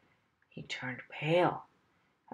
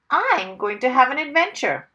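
determined declaration; 6 semitones higher, 7 dB louder than usual. A narrator's reading. Mister Wumble declares his decision.

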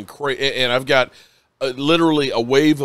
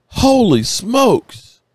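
amazed exclamation; 8 semitones higher, much slower than usual. Real, not acted. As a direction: belt it out in awe. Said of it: "I'm sorry if I'm a little emotional".

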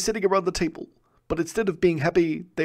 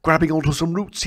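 ordinary delivery, nothing marked

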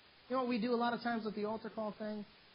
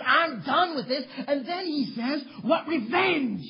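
loud, slow, rising preacher's roar; preaching at full force; 8 semitones higher, 14 dB louder, slower than usual. Acted. Preacher crying out to the congregation at full force. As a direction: build the sermon to a shouting climax.

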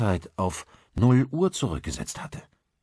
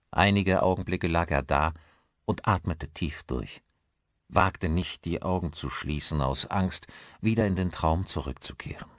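ordinary delivery, nothing marked

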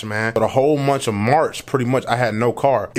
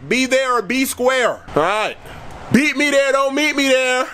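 hyped announcement; 9 semitones higher than usual. Acted. Greeting Kansas on game day, high excited announcer voice. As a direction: pump up the crowd like a hype man.

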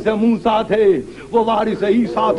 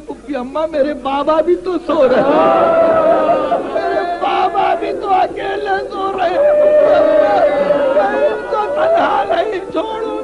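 plea rising into a high wail; distressed child's plea; 7 semitones higher than usual. Acted. Little Sakina pleading with her aunt beside her father, distressed. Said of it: "تو کہنے لگی گھبرا کے".

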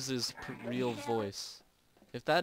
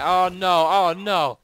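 loud alarmed cry; sudden loud gamer panic; panicked oh no; 4 semitones higher, 10 dB louder than usual. Real, not acted. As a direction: yelp it in panic. Startled in-game panic.

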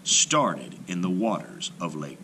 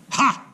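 high gleeful ha; sharp high ha; 7 dB louder than usual. A narrator's reading. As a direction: bark a delighted ha. Narrator exclamation.

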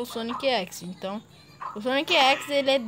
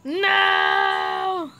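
loud high shout of frustration; frustrated shout; 8 semitones higher, 5 dB louder than usual. Real, not acted. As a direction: yell it out at the failed jump. Fails jump, shouts.

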